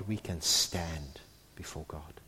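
soft, low whispered promise. Acted. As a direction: breathe it out as a whisper. Tender close of sermon.

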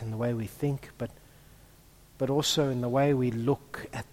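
soft, low prayerful voice. Acted. Pastor leads the church in a hushed opening prayer. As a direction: pray quietly and reverently.

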